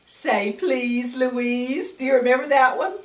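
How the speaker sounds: usual speaking voice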